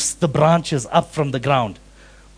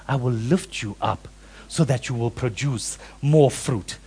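gentle voice of Jesus; 7 semitones lower, 6 dB quieter than usual. Acted. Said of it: "he is essentially saying".

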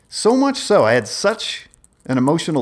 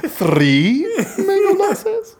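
much higher, very slow, hammed-up question breaking into a laugh; exaggerated suggestive owner; 3 dB louder, much slower than usual. Owner repeating the order to the table, hammed-up suggestive drawl with a laugh. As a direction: overplay the showy host until you laugh. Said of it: "it was always like this suggestive something".

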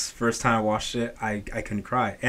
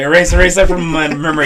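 mock desperate plea; 5 semitones higher, 10 dB louder than usual. Retold plea after seeing a spoiler.

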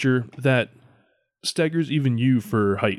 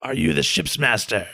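alien creature. Acted.

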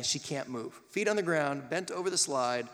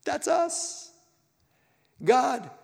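exclaiming; 12 semitones higher, 6 dB louder, much slower than usual. Real, not acted. Sudden exclamation.

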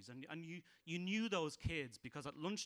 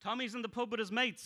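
louder, higher joke telling; joke setup; 8 semitones higher, 6 dB louder than usual. Acted. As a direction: launch into the setup.

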